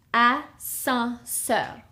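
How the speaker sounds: Ascenseur slowed, nasal "a-san-seur"; Ascenseur, drawn out for learners to copy; slow French teaching repeat; slow French model. Shown as a demonstration. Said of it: "The second one is ascenseur".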